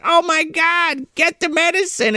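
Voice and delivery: high, drawn-out frantic plea; hammed-up panicked cry, slow and high; mock panic. Podcaster imitating the woman losing John's medicine, mock panic.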